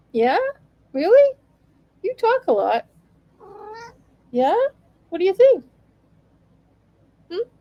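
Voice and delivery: high, questioning pet voice; playful high-pitched chat with a cat; talking to a pet. Real, not acted. Pretend conversation with her cat.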